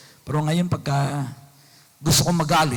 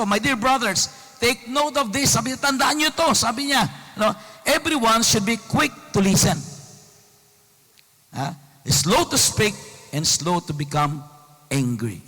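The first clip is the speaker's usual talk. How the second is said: scripture reading; 6 semitones higher than usual. Acted. Reads Bible verse again.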